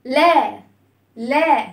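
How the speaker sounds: لا as an open, drawn-out "laa"; open vowel. Shown as a demonstration. Teacher shows children lam with fatha, open-voweled لا. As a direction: open the mouth on لا, sounding "laa". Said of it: "هنفتح الصوت".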